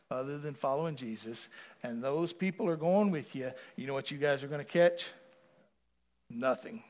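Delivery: ordinary delivery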